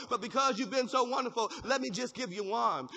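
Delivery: usual speaking voice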